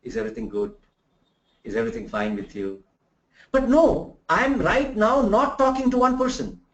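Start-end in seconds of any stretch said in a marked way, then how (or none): 0.0-2.8 soft, close conversational voice; low-key chat to a single listener; quiet one-to-one talk. Shown as a demonstration. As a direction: talk as if to one person beside you. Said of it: "I would talk like this".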